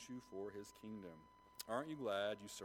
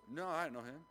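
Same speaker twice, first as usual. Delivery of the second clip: higher, louder acted denial; insistent denial; 7 semitones higher, 5 dB louder than usual. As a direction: push back at the accuser, voice raised. Acting Peter's repeated denial.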